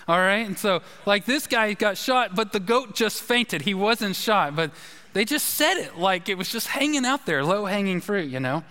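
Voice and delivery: ordinary talk, nothing marked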